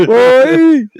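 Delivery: loud, high Jamaican exclamation; hammed-up Jamaican holler; mock Jamaican accent. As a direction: belt it in fake Jamaican. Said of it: "making fun of that accent".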